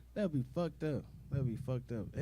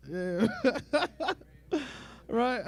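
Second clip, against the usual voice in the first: laughing; 8 semitones higher, 6 dB louder than usual. Real, not acted. Laughing at own joke.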